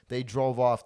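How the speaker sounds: ordinary delivery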